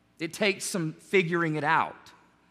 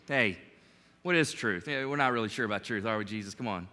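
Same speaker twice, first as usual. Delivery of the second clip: lower, softer, quicker brush-off; dismissive shrug; 8 semitones lower, 5 dB quieter, faster than usual. Retold or imitated. Pilate brushes off Jesus in a low dismissive mutter. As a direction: wave the question away lightly. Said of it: "He backs up and says".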